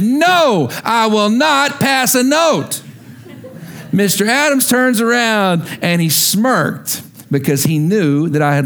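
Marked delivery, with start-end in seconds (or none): 0.0-2.8 slow, deliberate refusal; boy refusing the note, slow and firm. Retold or imitated. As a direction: lay down each word firmly. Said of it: "I said".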